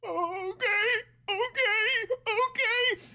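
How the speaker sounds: put-on a frightened six-year-old agreeing through tears; high whimpering okays; scared crying kid. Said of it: "six years old going".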